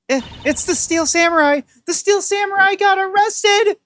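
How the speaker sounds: put-on high, loud panicked girl voice; frantic high voice. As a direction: blurt it out in a panic. Maya voiced, frantic news.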